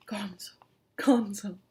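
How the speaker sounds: a ghostly whisper of his name; whispered call. Acted. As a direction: hiss his name like the fish calling. Fish calling his name.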